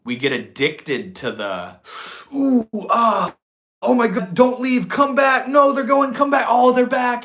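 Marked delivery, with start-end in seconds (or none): 2.3-7.2 high, loud hammed-up frenzy; shrieky send-up of clingy lover panic; mock panic. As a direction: plead frantically like a drama addict. Acts out addiction to relationship drama.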